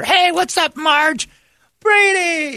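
high, loud put-on hello voices; mock greetings. Ballpark staff greeting Brady in elevator, high loud voices. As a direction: toss out cheery hallway hellos.

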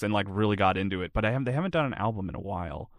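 ordinary talk

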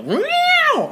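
sudden high cat screech; imitated cat meow. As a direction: yowl like a startled cat. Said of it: "I hear a cat go".

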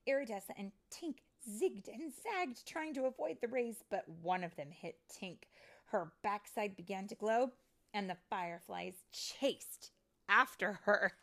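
animated read-aloud with lingering key words; storybook narration. A narrator's reading. Storyteller reading fairy tale to children, animated narration. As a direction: tell it dramatically to young children.

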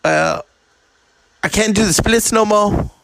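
a hint of [name] lamenting. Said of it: "then he's like".

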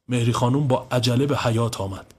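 ordinary delivery

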